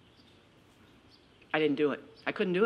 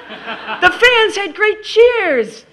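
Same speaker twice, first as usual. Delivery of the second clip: high, slow, gushing cheer; hammed-up giddy schoolgirl voice; gushing teen; 13 semitones higher, 10 dB louder, much slower than usual. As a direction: read it like a bubbly teenage fan. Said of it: "she'd write something like".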